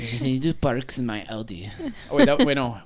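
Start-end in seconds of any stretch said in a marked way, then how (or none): 0.3-1.7 soft, lower mock Eastern European accent; attempted Romanian accent. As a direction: imitate a Romanian woman's accent. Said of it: "Was she Romanian?".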